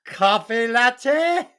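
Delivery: drawn-out posh-faking query; British accent. Acted. Holmes asks for a latte, bad British accent. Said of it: "worst British accent I've ever heard".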